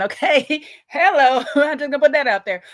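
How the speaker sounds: high, loud, laughing aside. Real, not acted. Making a point about the party to viewers, laughing. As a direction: let real laughter break through the words.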